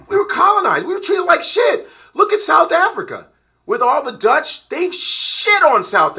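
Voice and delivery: heated outburst. Real, not acted. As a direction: let the anger about colonization burst out. Heated rant on colonialism.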